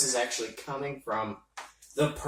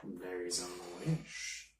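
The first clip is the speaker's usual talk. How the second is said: mysterious entity voice; 7 semitones lower, 13 dB quieter than usual. Acted. Entity speaks low.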